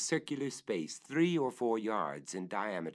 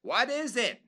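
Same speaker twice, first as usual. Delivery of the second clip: much higher, louder eager call; thrilled at the discovery; delighted master calling up; 6 dB louder than usual. Acted. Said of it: "highly delighted".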